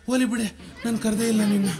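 high-pitched, timid backing down; cowed husband. Acted. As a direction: hastily deny it to your wife, meekly. Husband backs down before wife.

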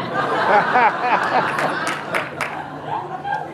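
laughing at his own punchline; real laughter. Real, not acted. Pastor laughing with the congregation at the story, real laughter. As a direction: let the laugh spill out.

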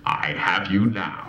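deep, slow demonic voice; possessed bride. Retold or imitated. Possessed wife at wedding.

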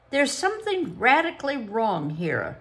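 lightly voiced strict examiner; stern examiner. A narrator's reading. Psychologist puzzled.

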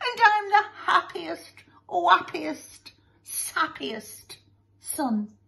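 high, hammed-up happy sobbing, fading away; tearful boy. Boy tells parents he's happiest, mock tearful. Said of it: "boy burst into tears".

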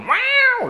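high squeaky meow like a cat. Pretend. Gamer showing off his cat impression: high, loud meow. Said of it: "je fais très bien le chat".

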